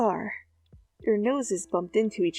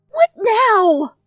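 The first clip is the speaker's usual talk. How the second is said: irritated snap; 13 semitones higher, 9 dB louder than usual. Acted. Zuko snapping irritably.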